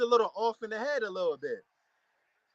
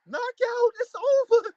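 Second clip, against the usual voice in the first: very high, loud fight call; excited outburst; 7 dB louder, slower than usual. Real, not acted. Fight fan reacting to a sudden knockout, excited outburst. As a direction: cry out in real shock at the finish.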